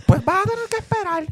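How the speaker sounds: high-pitched put-on mother voice; mamá. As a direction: imitate mom brushing off her child in a squeaky pitch. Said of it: "Y mami". (voz aguda imitando a mamá)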